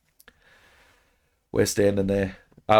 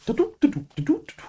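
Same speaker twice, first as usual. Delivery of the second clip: put-on high squeaky toddler babble, much higher. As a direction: mimic a small kid chanting nonsense. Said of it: "the kid's going".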